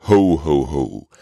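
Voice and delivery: a hint of a falling Santa chuckle; Santa's ho-ho. Host greets listeners as Santa, jolly ho-ho. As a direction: lightly play Santa's greeting.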